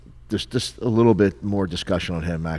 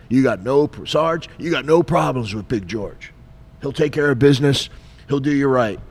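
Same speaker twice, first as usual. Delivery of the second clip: higher, louder gung-ho sergeant; hearty sergeant voice; 7 semitones higher, 6 dB louder than usual. Retold or imitated. Sergeant assuring the investigator about George, loud and hearty. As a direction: vouch like a booming drill sergeant.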